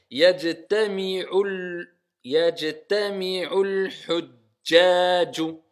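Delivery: Yajtami'ul hujjaju, slow, the l run straight into hujjaju; linked lam. Shown as a demonstration. Teacher showing students how the words join. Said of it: "ici, on fait la liaison".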